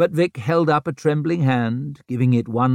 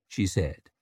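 plain reading voice

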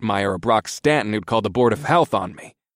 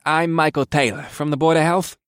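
higher-pitched apologetic official; 6 semitones higher than usual. A narrator's reading. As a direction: introduce yourself as if sorry to intrude. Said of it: "said in an apologetic tone".

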